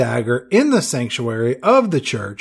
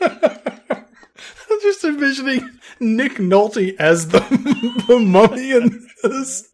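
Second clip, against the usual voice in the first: laughing; 9 semitones higher, slower than usual. Real, not acted. Laughing while talking.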